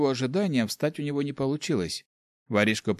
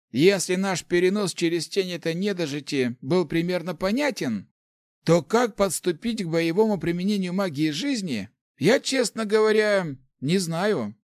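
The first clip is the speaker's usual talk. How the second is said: put-on high-pitched lively old mage; Lars, elderly mage; 8 semitones higher than usual. A narrator's reading. Old mage admitting ignorance to his colleague, high and animated. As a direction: voice an old teacher in a raised pitch.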